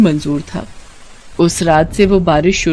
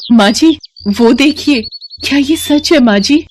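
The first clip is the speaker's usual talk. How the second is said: higher, astonished calling out; can't believe her eyes; daughter-in-law spotting her lost husband, astonished; surprised; 5 semitones higher than usual. Acted. Suddenly spots missing husband Sundar.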